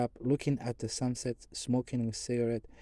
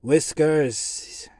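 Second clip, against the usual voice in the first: stern; 4 semitones higher, 8 dB louder than usual. A narrator's reading. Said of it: "he answered sternly".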